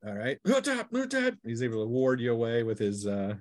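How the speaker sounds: ordinary talk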